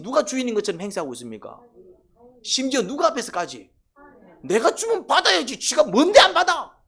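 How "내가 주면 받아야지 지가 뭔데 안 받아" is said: high, loud, indignant yell as Cain; indignant outburst. Acted. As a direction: shout it like an offended man whose gift was refused. Voicing Cain's indignant outburst.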